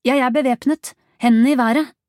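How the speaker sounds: loud, high commanding yell; forceful armed challenge; shouted police warning. A narrator's reading. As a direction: shout down a suspect who won't respond.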